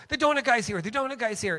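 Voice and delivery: high, loud shout spreading the news; imitated excited scream. Street people alerting others the donut guy arrived, imitated scream. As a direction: mimic people calling out to each other. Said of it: "they would scream".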